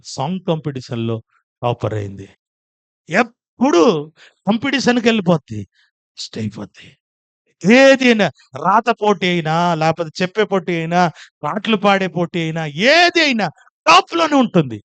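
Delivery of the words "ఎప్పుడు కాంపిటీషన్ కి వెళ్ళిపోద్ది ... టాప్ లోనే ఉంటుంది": preaching at full force. Acted. Boasting daughter's wins to congregation.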